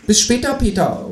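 high kangaroo call from the doorway. A narrator's reading. The kangaroo calls goodbye from the doorway. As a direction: call it back over your shoulder. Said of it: "ruft das Teutelbier".

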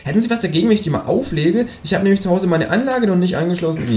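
high, bouncy know-it-all kangaroo; cheeky kangaroo voice. Acted. Kangaroo lecturing the narrator about precious time, high and cheeky. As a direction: play the smug kangaroo, pitched well up.

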